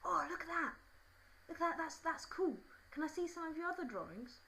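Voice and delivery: high put-on boy voice, admiring; impressed schoolboy. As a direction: play an eager boy marvelling at a drawing. Acts impressed boy behind her.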